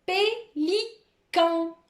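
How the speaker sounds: Pélican high and very slow, three separate syllables. Shown as a demonstration. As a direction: pause between pé, li and can. Teacher dictates a word by syllables.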